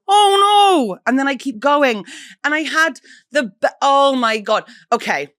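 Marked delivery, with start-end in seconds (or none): 0.1-1.0 high, loud mock dismay; high loud playful oh no. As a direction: squeal it as a cheerful oops. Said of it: "I just go".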